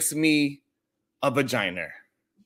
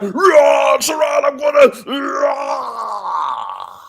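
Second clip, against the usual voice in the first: mock roaring threat, higher and louder; Hulk-like rage; 8 semitones higher, 4 dB louder, much slower than usual. The panel raging at him, a hammed-up Hulk roar. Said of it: "They turned into the Incredible Hulk on me".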